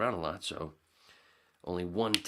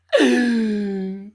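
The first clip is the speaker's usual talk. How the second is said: dramatic gasp; 20 dB louder than usual. Pretend. After the sung line.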